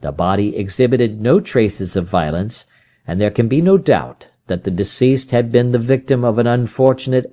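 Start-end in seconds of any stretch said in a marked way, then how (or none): none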